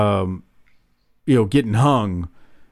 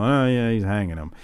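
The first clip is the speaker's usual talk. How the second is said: deadpan; 3 semitones lower, 4 dB quieter than usual. Retold or imitated. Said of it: "I'd be seventeen at this point".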